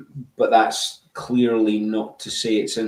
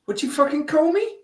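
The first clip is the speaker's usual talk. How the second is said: much higher, mock outraged tough guy; offended tough guy. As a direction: squeal it out as a mock-offended bloke. Mock offended South African reply.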